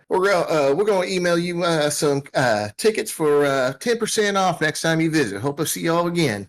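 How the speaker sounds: high, easygoing put-on country voice; folksy comm operator. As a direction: chat amiably in a high rustic voice.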